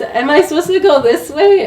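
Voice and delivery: high, loud wondering ask; amazed question. Retold or imitated. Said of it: "And I was".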